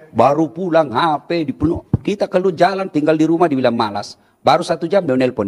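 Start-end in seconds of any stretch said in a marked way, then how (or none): none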